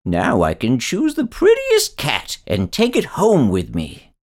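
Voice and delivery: a mild burst of delight; old man happily choosing a cat; joyful old man. A narrator's reading. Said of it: "cried the old man joyfully".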